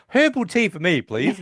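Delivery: high-pitched quoted order; imitating Sheldon. Recapper quoting Sheldon as he orders tea, high imitation.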